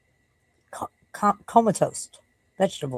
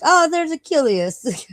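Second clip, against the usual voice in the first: higher, slow mock neighbor voice; imitating neighbor Dave; 11 semitones higher, 5 dB louder, slower than usual. Neighbor Dave greeting the dog, higher and slow. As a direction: ham up the neighbor's cheerful mispronounced call. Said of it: "he'll be like".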